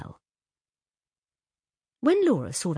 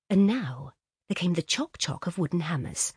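ordinary delivery, nothing marked

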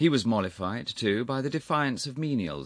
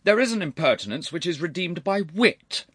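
loud, high severe reproof; 11 semitones higher, 8 dB louder than usual. A narrator's reading. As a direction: lecture him sternly, voice raised. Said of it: "said Sir Charles severely".

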